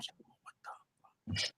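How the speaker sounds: breathless whispered disbelief; awed whisper. Retold or imitated. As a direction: hush it like you can't believe it. Amazed at the car's touchscreen.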